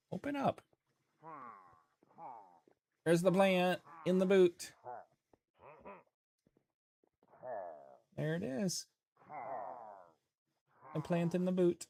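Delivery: high, sing-song voice for a pet; pet-talk voice. Real, not acted. Streamer feeding a plant to a pet, lightly high sing-song. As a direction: coo at the animal in a lifted voice.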